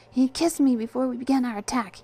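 defensive rushed claim; hurried. A narrator's reading. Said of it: "in a hurried manner".

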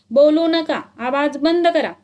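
loud, high teacher's shout; teacher shouting over noisy pupils. A narrator's reading. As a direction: bellow to silence the class. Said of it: "खच्चून ओरडले".

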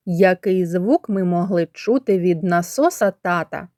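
ordinary talk, nothing marked

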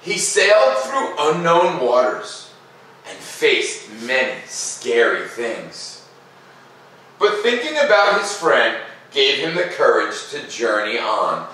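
slow, lilting read-aloud voice; gentle storyteller pacing, each phrase drawn out; story narration. A narrator's reading. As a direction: read it like a bedtime story for kids. Reading the picture book aloud.

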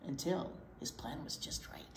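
whispered, sneaky; conspiratorial whisper. Acted. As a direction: hush it like a secret plan. Sneaky scheming.

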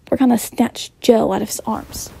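ordinary delivery, nothing marked

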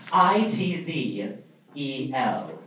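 I T Z E L, each letter slowly and separately; curt, slow letter-by-letter spelling; spelled out. Shown as a demonstration. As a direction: spell the name out slowly and deliberately. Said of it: "That is my name".

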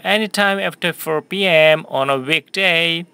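slow, clear sentence for repeating; teacher modelling a question slowly for students; slow model reading. Shown as a demonstration. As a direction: read it slowly for the class to echo. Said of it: "한 번 더요".

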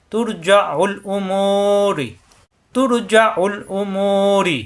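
তুর heavy, রি light, slowly chanted; tajweed recitation. Shown as a demonstration. Teacher repeats the example, heavy and light ra, slow chant. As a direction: chant তুর thick and রি thin. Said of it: "তুর এখানে মোটা হবে".

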